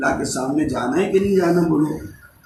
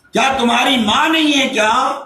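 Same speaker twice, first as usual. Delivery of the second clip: raised, high-pitched insistent question; urgent questioning; 9 semitones higher, 4 dB louder than usual. Acted. Saint asking a crying boy about his father, urgent. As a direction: press the question with a preacher's force.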